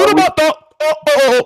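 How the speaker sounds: very high mock African exclamations; African accent. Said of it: "I'm gonna start speaking African".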